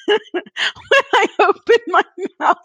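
high squeaky laugh through the words. Real, not acted. Confessing about stomach noises to cohosts, laughing hard. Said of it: "there's a noise coming out".

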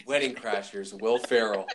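ordinary talk

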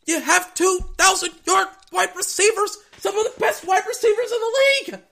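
very high, loud outraged squeal; high-pitched exasperated shout; incredulous yelling. Retold or imitated. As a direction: shriek it in disbelief at the coaches. Acted incredulous outburst at play calling.

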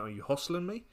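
softly asked, serious question; understated serious challenge. Acted. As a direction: ask it quietly, in earnest. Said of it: "says it a more serious time".

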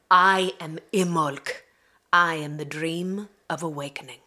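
measured, solemn invocation, each phrase given weight; ritual poem reading. Acted. A goddess voice invoking the listener's fire, solemn and measured. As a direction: speak as the goddess calling the listener to rise.

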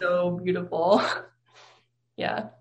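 choked-up voice, fighting tears; trying not to cry. Real, not acted. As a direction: voice catching, holding back tears. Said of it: "I'm trying not to cry".

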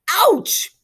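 very high, loud yelp of pain; screamed. A narrator's reading. Man struck in the kneecap, screaming. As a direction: scream as the blow lands. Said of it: "screamed the man".

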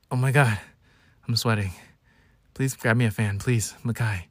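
mildly hammed-up weak invalid; drama-king pleading. Sick drama king begging his little brother for a fan, mock feeble. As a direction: plead feebly in short, separated phrases. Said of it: "I am such a drama king".

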